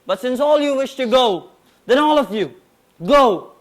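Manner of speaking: building to a loud furious dismissal; rising anger, then spit out the last word; angry. Acted. Dismissing his army in anger.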